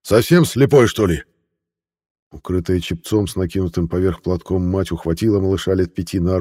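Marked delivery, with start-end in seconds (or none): 0.0-1.2 angry. Acted. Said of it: "рявкнул со злобой".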